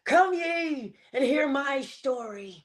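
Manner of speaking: high, calling out like a herald; town crier. Retold or imitated. Speaker calls to listeners as a town crier, high and ringing. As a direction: announce it to the whole street, pitch up. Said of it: "like a town crier saying".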